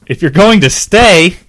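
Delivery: shouting it high and loud; a furious girlfriend yelling; angry yell. A narrator's reading. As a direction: snap back at him in anger. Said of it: "Teresa yelled".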